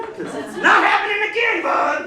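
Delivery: high, loud mock-furious yell; hammed-up competitive outburst; defiant shout. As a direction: shout down a childhood rival. Acted taunt of old tag rival.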